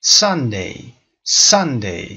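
Sunday with a loud, strong first syllable; stress on first syllable. Shown as a demonstration. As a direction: hit SUN, let day drop. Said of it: "استرس همچنان قسمت اول".

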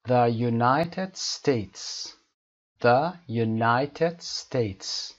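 the United States very slowly, NI of United stressed; slow stressed model. Shown as a demonstration. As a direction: drawl it with weight on NI. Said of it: "کلمهٔ United قسمت نه". (تأکید روی نای در United)

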